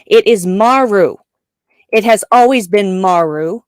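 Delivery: "Maru" with a loud, punched first syllable; insist, hitting the MA in "Maru"; stress on first syllable. Shown as a demonstration. Fan correcting co-host's pronunciation, first-syllable stress on "Maru". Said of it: "put the emphasis on the first syllable".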